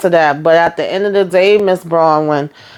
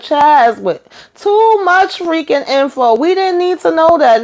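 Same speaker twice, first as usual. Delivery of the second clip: high-pitched, drawn-out exasperation; exasperated scolding; 10 semitones higher than usual. Retold or imitated. Mock-scolding Chaz for oversharing, in a high exasperated voice. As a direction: scold him like he overshared badly. Retelling her own reaction to Chaz.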